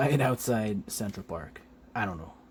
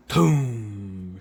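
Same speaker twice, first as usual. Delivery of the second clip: loud, drawn-out boom; imitated blast; 7 dB louder than usual. Narrator showing Shriek's giant blast, loud drawn-out boom. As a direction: boom it out like an explosion.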